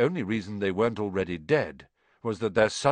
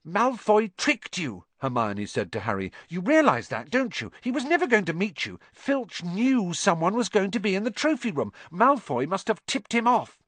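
Hermione voice; 9 semitones higher than usual. A narrator's reading. Hermione's voice in narration.

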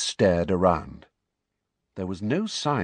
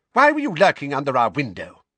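livid shrill aunt; 7 semitones higher, 7 dB louder, faster than usual. Acted. Livid Petunia accuses Harry.

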